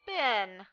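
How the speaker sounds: been said as bin, short i sound; pronounced bin. Shown as a demonstration. Teacher showing been said as bin. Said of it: "Notice it's pronounced B I N".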